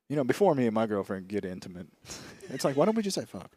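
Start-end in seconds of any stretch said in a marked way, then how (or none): 0.1-1.9 a hint of prim, proper phrasing; lightly mocking prim tone. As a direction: say it like a polite euphemism. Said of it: "and we go".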